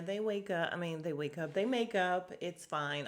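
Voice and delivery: ordinary talk, nothing marked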